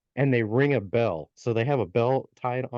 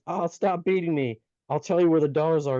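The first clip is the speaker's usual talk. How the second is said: pleading gnome; 6 semitones higher than usual. Acted. Beaten gnome pleads with brother.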